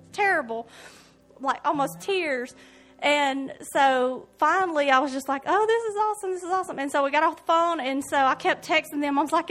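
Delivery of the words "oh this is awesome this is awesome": 1 mild delight. Retold or imitated. Retold joy after healing prayer.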